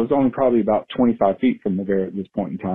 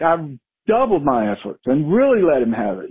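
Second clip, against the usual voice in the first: usual speaking voice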